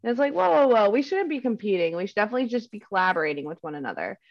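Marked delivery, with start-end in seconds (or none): none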